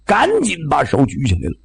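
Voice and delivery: ordinary delivery, nothing marked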